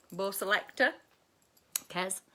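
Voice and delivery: ordinary talk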